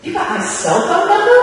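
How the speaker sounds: incredulous. Retold or imitated. Speaker mocking her own reaction to the agent, incredulous. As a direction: ask it in mock disbelief.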